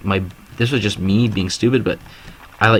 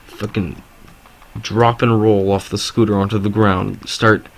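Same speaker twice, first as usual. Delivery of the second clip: ordinary talk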